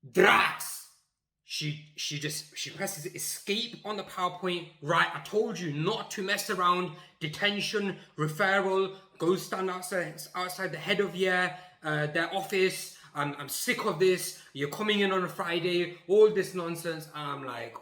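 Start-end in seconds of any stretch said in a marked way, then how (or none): 0.1-0.8 sudden, high-pitched blurted shout; one explosive, insanely loud word. Retold or imitated. Third shouted outburst after tense pause. 4.8-17.1 raised, higher scolding voice; exasperated mock telling-off; retold angry teacher.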